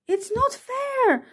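put-on high, drawn-out childish protest; indignant shout. A narrator's reading. Losing spider team protesting to the winners, indignant cry. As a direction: whine it out slowly, high-pitched. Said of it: "the spiders on Scrabble's team shouted".

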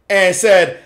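ordinary talk

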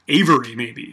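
usual speaking voice

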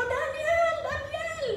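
king calling for Daniel at dawn, high-pitched; anguished shout. Retold or imitated. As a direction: cry out his name in desperation. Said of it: "tigargar, yung boses na".

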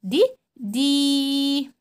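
دي stretched very long; very long drawn-out دي; long drawn vowel. Shown as a demonstration. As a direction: hold the ee of دي. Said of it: "صوت واحد ولكن طويل جدًا".

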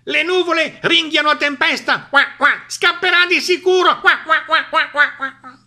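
high, loud, gleeful quacking tirade; squawking duck. A narrator's reading. Duck gloats that Tempesta will flee, high squawking. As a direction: squawk it out, trailing into a run of quacks. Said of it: "schiamazzò Papera".

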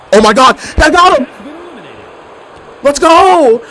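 high, loud triumphant shout; victory yell. Real, not acted. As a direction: celebrate the win at full voice. Winning the match.

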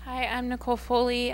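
ordinary delivery

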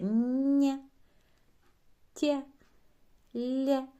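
soft consonants said smiling; soft syllables with a smile. Shown as a demonstration. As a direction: smile through нє, тє, лє. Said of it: "З усмішкою ми вимовляємо".

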